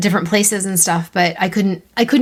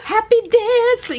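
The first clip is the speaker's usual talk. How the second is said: high, slow gleeful singsong; singsong celebration; much slower than usual. Pretend. Writer celebrating a nailed character, singsong glee. Celebrating being recognised by readers.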